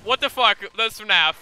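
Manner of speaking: loud high shocked outburst; startled shout. Real, not acted. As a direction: blurt it out in shock. Shocked by game.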